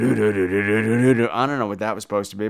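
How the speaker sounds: low puzzled mutter. Real, not acted.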